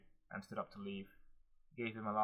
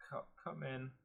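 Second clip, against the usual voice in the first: hoarse; 5 semitones higher, slower than usual. A narrator's reading. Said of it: "Hilana uttered hoarsely".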